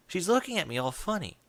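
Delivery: usual speaking voice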